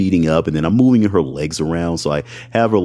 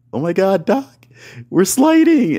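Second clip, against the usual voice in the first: mock alarmed squeal; 13 semitones higher than usual. Partner alarmed, mattress sliding.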